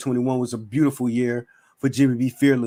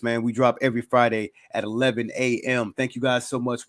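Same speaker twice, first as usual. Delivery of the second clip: normal talking voice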